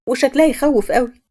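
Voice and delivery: ordinary delivery, nothing marked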